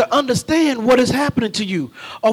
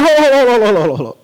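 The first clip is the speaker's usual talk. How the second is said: louder, then fading hurried calls to stop; mock frantic car owner; 5 dB louder, faster than usual. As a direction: stop them before they slam the door. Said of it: "they're like".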